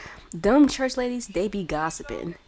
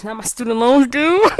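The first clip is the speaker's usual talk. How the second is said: loud, higher-pitched dismay breaking into laughter; real comic outcry; 7 semitones higher, 11 dB louder than usual. Real, not acted. Player startled by student loans due, loud comic outcry. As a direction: exclaim in laughing disbelief.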